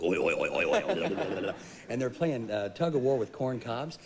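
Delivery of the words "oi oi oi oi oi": hog grunts. Retold or imitated. Describing the hogs at their slop, grunting oi oi. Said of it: "They're going".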